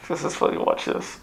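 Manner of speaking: a hushed, breathy aside from God; God chuckling. Retold or imitated. God quietly amused at the speaker. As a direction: whisper it like God enjoying a private joke. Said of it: "but he's like".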